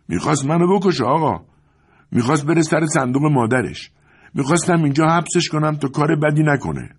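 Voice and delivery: agitated servant. A narrator's reading. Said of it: "نوکر زیر لب گفت".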